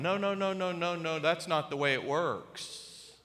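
normal talking voice

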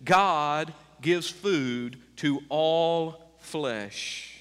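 very slow, deliberate reading; slow scripture reading. Acted. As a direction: read each word as a verse.

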